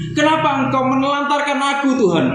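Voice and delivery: high, hurt question to God; abandoned lament. Acted. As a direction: cry out like someone left behind. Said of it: "Dia merasa dia ditelantarkan". (seruan pilu bernada tinggi)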